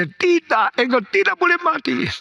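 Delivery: very high, fast outcry; frantic refusal. Retold or imitated. Wife telling her husband he must not die, frantic refusal. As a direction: forbid it in a panicked high voice.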